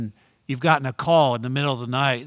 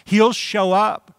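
excited; 7 semitones higher, 5 dB louder, slower than usual. Real, not acted. Preacher exclaiming a promise.

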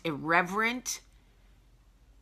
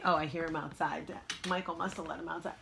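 ordinary talk, nothing marked

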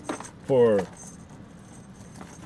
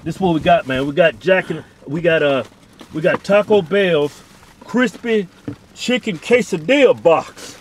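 excited showing off; 5 semitones higher, 10 dB louder than usual. Real, not acted. Showing the camera their Taco Bell, loud and excited. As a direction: present the meal like a prize.

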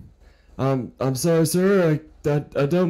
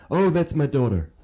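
ordinary delivery

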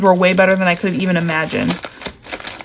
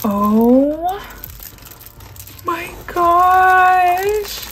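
stretched out, much higher, in disbelief; awed squeal; 10 semitones higher, much slower than usual. Real, not acted. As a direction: let it rise into a delighted gasp. Awed at the kit.

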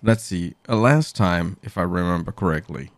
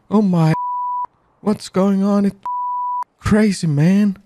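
pitched-up voice effect; 12 semitones higher, 3 dB louder than usual. Shown as a demonstration. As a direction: talk through a pitch-up effect. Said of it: "I can swear".

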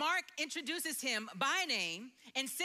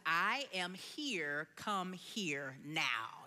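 low, very slow mother's command; stern mommy voice; 7 semitones lower, much slower than usual. Retold or imitated. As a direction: lay down the law like mom, word by word. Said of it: "I put my mommy voice on".

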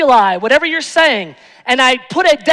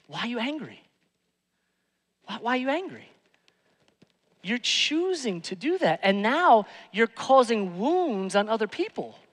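soft questioning; 7 dB quieter than usual. Real, not acted. Softer pastoral questioning.

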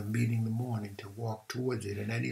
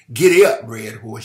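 a big joyful shout, much louder; cried out; 17 dB louder than usual. A narrator's reading. Cleo on the wild horse, shouting to urge it on. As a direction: yell it to spur the horse on. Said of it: "she cried".

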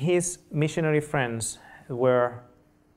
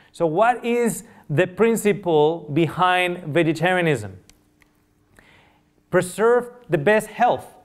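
raised, emphatic sermon voice; preaching; 4 semitones higher, 4 dB louder than usual. Acted. Preacher driving home the principle of vegetarianism, emphatic. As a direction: proclaim it to the congregation.

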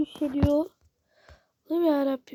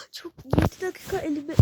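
usual speaking voice

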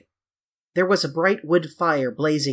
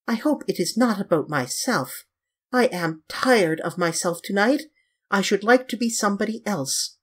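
higher voice with mild petulance; petulant Dorian; 7 semitones higher than usual. Acted. Dorian flung on the sofa, tired of himself, petulant. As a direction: play a self-weary young gentleman, pitched up.

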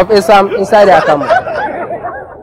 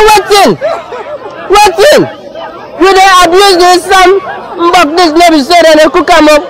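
high-pitched indignant disbelief, acted out; shocked; 10 semitones higher than usual. Husband complains to wife about the esam, shocked. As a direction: imitate a husband scandalised by what he is served. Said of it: "He shock".

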